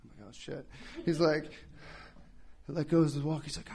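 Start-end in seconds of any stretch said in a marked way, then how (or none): 0.3-0.7 very soft dreading whisper. Retold or imitated. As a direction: breathe it out in dread. Dreading the stunt.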